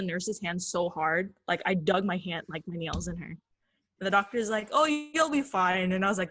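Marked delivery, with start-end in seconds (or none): 4.7-5.9 high, slow, drawn-out soothing; imitated singsong doctor comfort; breezy reassurance. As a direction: reassure a frightened child, stretching it out. Doctor soothing a screaming child.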